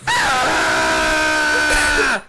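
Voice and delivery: loud high-pitched scream from the stall; mock scream. Retelling the bathroom kid, a loud high-pitched shriek. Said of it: "way more high pitched".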